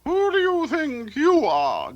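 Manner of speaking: high, loud grouchy little ladybug. A narrator's reading. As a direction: snap at Peep like a cranky bug. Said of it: "grouched the ladybug".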